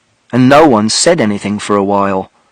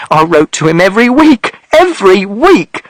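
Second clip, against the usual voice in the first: mother shouting; 8 semitones higher than usual. A narrator's reading. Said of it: "And mother shouted".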